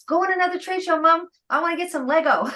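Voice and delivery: high-pitched imitation of an excited son; eager young son. Son urging mom to another trade show, mock eager kid voice. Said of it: "my son used to be like".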